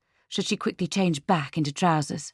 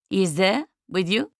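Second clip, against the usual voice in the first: put-on higher, French-accented question; Marie-Claude; 4 semitones higher, 4 dB louder than usual. A narrator's reading. Checking on the phone who is with Lucia, French accent. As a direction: ask it in French-accented English. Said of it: "Marie-Claude's accent".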